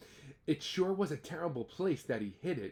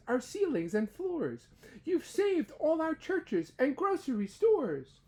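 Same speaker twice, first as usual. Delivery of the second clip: high little voice from a dust speck; tiny mayor thanking Horton; tiny Who voice; 11 semitones higher than usual. Acted. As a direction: squeak it up small and grateful. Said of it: "came the voice".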